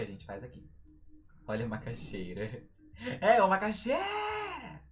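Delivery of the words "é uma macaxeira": high, loud, drawn-out street cry; vendor's call. Shown as a demonstration. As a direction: hawk it, stretching XEI in macaxeira.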